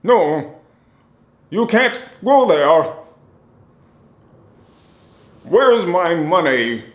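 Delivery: dubbed villain shout. Retold or imitated. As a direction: yell it like an overdubbed kung fu thug. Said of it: "be like".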